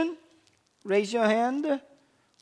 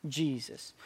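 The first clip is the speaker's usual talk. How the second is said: hushed answer; 8 semitones lower, 12 dB quieter than usual. Acted. Call-and-answer, answering own question.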